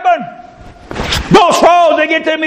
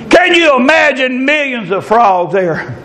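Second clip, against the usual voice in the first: usual speaking voice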